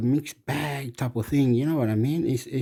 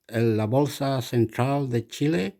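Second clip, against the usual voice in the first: usual speaking voice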